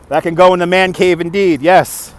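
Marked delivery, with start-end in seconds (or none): none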